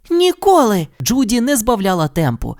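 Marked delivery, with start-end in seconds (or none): 0.1-0.9 high, determined cry; Judy's firm never. A narrator's reading. Judy refuses to quit, determined. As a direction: refuse with total resolve.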